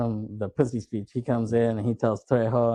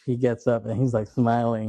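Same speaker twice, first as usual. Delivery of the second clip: normal talking voice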